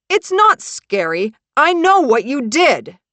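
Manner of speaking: frantic, loud, high-pitched outburst; hysterical. Acted. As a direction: lose control, voice climbing into hysteria. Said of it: "He sounds hysterical, frantic".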